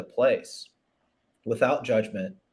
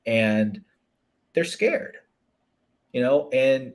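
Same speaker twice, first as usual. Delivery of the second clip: normal talking voice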